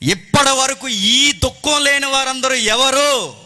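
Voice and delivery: loud, high, slow preacher's call; full-force preaching. Real, not acted. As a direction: ring it out slowly to the congregation.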